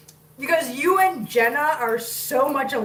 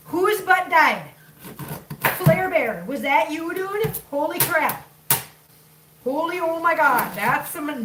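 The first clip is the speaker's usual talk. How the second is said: put-on Minnesota accent; 4 semitones higher, slower than usual. Demanding whose butt died, exclaiming in a Minnesota accent. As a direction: exclaim in mock outrage, broad Minnesota accent. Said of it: "she got a Minnesota accent going".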